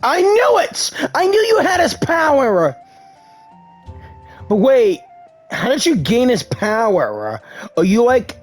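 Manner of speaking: usual speaking voice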